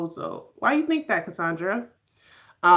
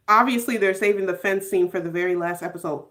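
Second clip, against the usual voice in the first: ordinary delivery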